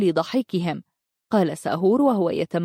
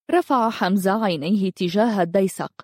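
usual speaking voice

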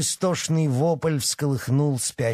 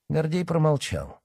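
plain reading voice

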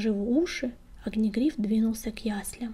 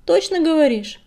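louder, higher voice; emphatic agreement; 6 semitones higher, 9 dB louder than usual. A narrator's reading. Белоснежка backs up the old cat, emphatic agreement. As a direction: chime in firmly, raised in pitch. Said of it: "поддержала старуху Белоснежка".

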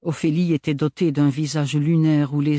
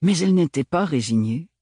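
ordinary delivery

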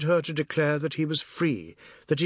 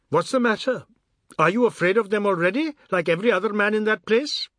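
higher, louder scornful question; annoyed challenge; 6 semitones higher, 4 dB louder than usual. A narrator's reading. Tara angrily challenging Biswas's courage. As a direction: needle him for his weakness.

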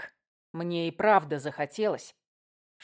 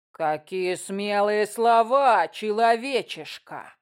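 slow, louder sneering goblin; red cap voice; 2 semitones higher, 5 dB louder, slower than usual. Acted. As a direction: taunt like a leering goblin. Red cap leader taunting.

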